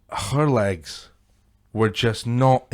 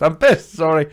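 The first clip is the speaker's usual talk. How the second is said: put-on higher, louder drunken outburst; tipsy blurted apology, pitched up; drunk apologiser; 3 semitones higher, 5 dB louder than usual. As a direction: imitate a drunk woman piping up with another apology. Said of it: "it was just on a repeat".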